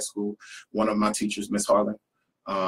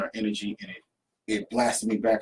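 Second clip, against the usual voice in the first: usual speaking voice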